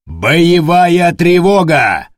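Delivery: loud, very slow barked command; barked order. Acted. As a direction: bark the alarm. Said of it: "рявкнул Дорофеев".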